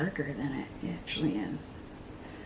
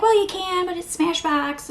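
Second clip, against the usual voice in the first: high, louder matter-of-fact correction; mock know-it-all; 10 semitones higher, 4 dB louder than usual. Friends correcting her in a high mock voice. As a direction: correct her like it's obvious. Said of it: "And they're like".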